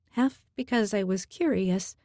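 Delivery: plain reading voice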